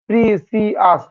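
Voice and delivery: Precious slowly broken into three beats; syllable by syllable. Shown as a demonstration. Teacher showing students precious takes three tries, slowly syllable by syllable. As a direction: sound out pre, সি, য়াস separately. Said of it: "তিনবার হচ্ছে".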